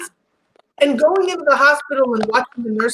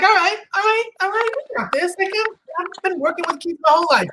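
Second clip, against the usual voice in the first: 1 overconfident new parent; 6 semitones higher than usual. Retold or imitated. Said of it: "we're like".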